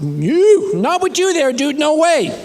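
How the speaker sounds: high, loud refusal; mock panicked protest. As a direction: back away from the needle, yelling. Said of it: "I said".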